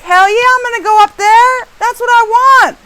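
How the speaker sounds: high, loud, gung-ho reply; acted high-pitched defiance; defiant excitement.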